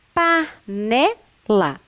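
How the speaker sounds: slow "Panela", pausing between pa, ne and la; syllable by syllable. Shown as a demonstration. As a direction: read Panela back in separate beats. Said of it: "L com A". (sílaba por sílaba, devagar)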